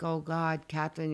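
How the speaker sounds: imitating a praying friend. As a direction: lightly voice the friend praying over her, hushed. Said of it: "She was praying over me".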